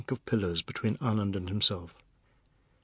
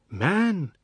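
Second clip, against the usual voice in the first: calling out; 7 semitones higher, 4 dB louder than usual. A narrator's reading. Wife calls him.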